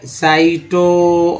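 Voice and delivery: each syllable drawn out slowly; slow syllable demo. Shown as a demonstration. Teacher to students, breaking a word apart slowly. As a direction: spell out साइटोटिक in slow, separate syllables.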